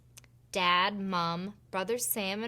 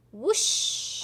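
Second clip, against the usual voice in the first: whoosh; 4 semitones higher than usual. Acted. Fairy flies off.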